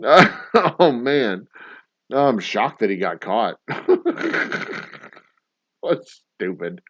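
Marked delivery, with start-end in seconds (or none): none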